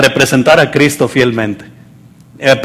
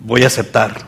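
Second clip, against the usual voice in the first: low, softened, humble voice; humble acceptance; 5 semitones lower, 5 dB quieter than usual. Acted. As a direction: give in quietly, pitched low. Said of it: "humillarse y decir".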